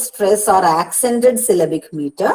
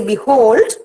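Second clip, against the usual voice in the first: "behold" with a light "be" and a forceful "hold"; stress on HOLD; 3 semitones higher, 4 dB louder than usual. Shown as a demonstration. Teacher showing where force falls, "behold" stressed hard on "hold". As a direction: punch the second syllable of "behold". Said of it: "force is given to the hold part".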